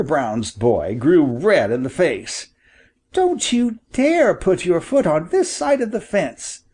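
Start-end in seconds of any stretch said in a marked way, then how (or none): 3.1-6.6 much higher, heated warning; angry cry. A narrator's reading. Boy warning the hunter off the land, crying out. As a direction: forbid him to cross, fiercely. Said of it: "he cried".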